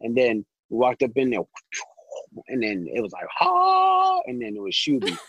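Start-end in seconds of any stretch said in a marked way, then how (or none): 3.3-4.2 long, high sustained note; high heavenly "ha". Retold or imitated. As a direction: imitate an angelic reveal sound. Retelling the magical reveal moment.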